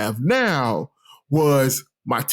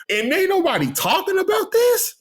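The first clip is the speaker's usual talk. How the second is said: high-pitched incredulous question; retold incredulity. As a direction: replay your disbelief, voice shooting way up. Retells his incredulous reaction.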